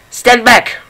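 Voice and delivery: put-on high, sharp shout of alarm, old man brandishing umbrella. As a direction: bark it out high to ward off an intruder. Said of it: "he shouted".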